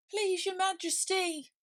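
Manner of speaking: high, slow pleading voice; pleading knave. Acted. Knave pleading with the king, high and slow. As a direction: plead it slowly.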